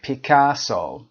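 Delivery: taunting Picasso, middle syllable drawn out; a touch of sarcasm on the stretched name; sarcastic. Shown as a demonstration. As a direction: say the name with a mild jibe. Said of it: "پیکاسو رو به یه شکلی طعنه آمیزی میگه".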